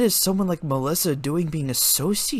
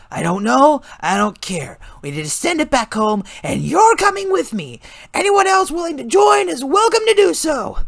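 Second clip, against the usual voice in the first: urgent commanding; 14 semitones higher, 7 dB louder than usual. A narrator's reading.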